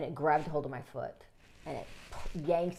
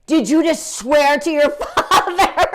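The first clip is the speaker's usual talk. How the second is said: higher, much louder, slow shocked scold, laughing; indignant father; 11 semitones higher, 16 dB louder, slower than usual. Retold or imitated. Father scolding son for swearing, indignant and loud. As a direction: play the shocked dad.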